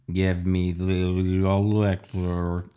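slow, garbled gurgle; waterlogged monster, dragging out the last word; gurgling fridge. A narrator's reading. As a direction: gurgle the words as if underwater. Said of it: "it gurgled as they pulled it out".

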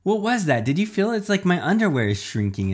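high-pitched, startled questions; lightly hammed-up startled bystander; alarmed reporter. A narrator's reading. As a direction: react in alarm, voice jumping up. Alarmed reporter reacts to odd sensation.

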